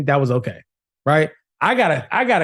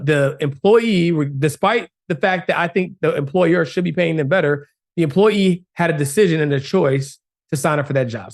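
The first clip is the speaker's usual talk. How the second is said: ordinary delivery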